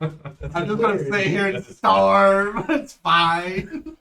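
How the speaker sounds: laughing. Real, not acted. Jokes after mom catches her.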